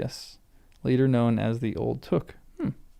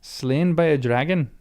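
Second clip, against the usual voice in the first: put-on playful try at a Scottish accent; slow, hammed-up Scottish lilt; 2 semitones higher, slower than usual. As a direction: put on a little Scottish brogue. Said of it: "could be a little Scottish".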